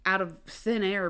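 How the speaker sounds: normal talking voice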